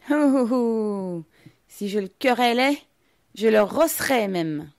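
high, very loud comical holler; bizarre comic shouting. A narrator's reading. Little Russian blustering about thrashing him, comic yell. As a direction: cry out in an oddball put-on voice. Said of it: "crier d'une voix bizarre et comique".

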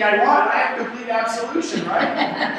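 laughing while joking. Real, not acted. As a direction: chuckle through the words.

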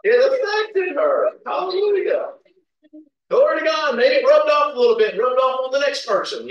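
loud, high-pitched preacher's exclamation; fervent preaching. Real, not acted. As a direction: preach it out with excitement. Preaches with rising fervour.

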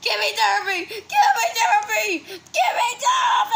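desperate demand. Acted. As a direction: build to a hammed-up yell for help. Acted repeated demand, building.

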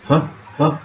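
normal talking voice

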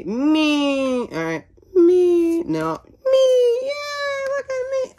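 very high-pitched, drawn-out squeal; piercing little squeaky cheer; squeaky character voice. Pretend. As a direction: squeal it out in a tiny high put-on voice. Squeals a cartoon catchphrase.

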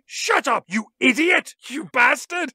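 high-pitched put-on indignant voice; hammed-up crime boss protest; outraged villain. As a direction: sputter at him in mock outrage. Lithgow protesting over radio, acted.

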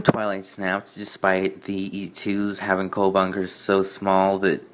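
ordinary delivery

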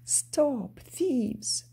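high-pitched storybook call. A narrator's reading. Rachel challenges Jack Frost and his goblins, calling out. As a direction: call out after thieves, pitched well up. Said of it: "Rachel called out".

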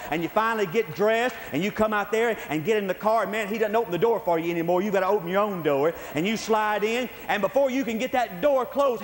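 usual speaking voice

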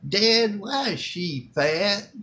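put-on high, loud kid's voice, slow; loud child question. Little son loudly asking his dad in the store, high and loud. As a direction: blurt it out like a small child. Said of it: "Says it loud".

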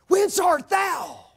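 high, loud, slow demanding question; urgent question. Acted. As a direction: press Jesus for an answer. Pilate questioning Jesus, acted.

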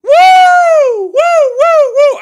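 very high, very loud hooting; wild woo. Real, not acted. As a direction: let out a wild whooping scream. Erupting over the footage.

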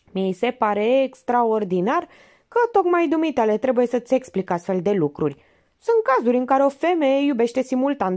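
high, pressing detective voice; Minerva interrogating. A narrator's reading. Minerva grills the suspect actor, high and pressing.

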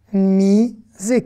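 "misé" slowly as a long mi, then sé; syllable by syllable. Shown as a demonstration. Teacher breaking down a Hebrew question, syllable by syllable. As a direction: stretch and separate both syllables of "misé". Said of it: "on va faire consonne plus voyelle".

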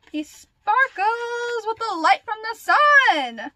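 high, loud, drawn-out gush; over-the-top gushing. Real, not acted. Fan raving about a band member's looks, dramatic gushing. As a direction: stretch it out in gushing adoration.

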